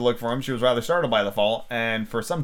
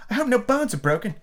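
anxious; 12 semitones higher than usual. A narrator's reading. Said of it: "in an anxious tone".

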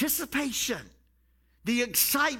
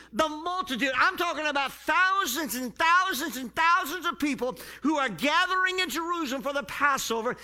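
usual speaking voice

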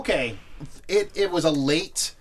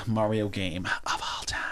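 slow hushed announcer whisper; dramatic whisper; 5 semitones lower, 7 dB quieter than usual. Acted. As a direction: build suspense in a whisper.

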